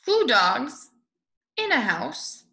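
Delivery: ordinary delivery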